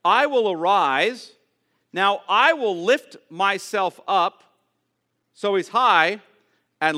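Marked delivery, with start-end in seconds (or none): 0.0-4.4 slow, loud, majestic declaration; slow, louder divine proclamation; voice of the Lord. Acted. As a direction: proclaim it like God rising. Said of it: "Who's speaking? All caps, Yahweh".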